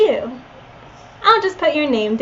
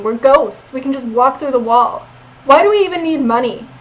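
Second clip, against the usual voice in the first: ordinary talk, nothing marked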